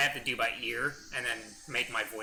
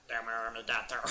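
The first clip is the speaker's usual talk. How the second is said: usual speaking voice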